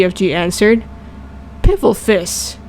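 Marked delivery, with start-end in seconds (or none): none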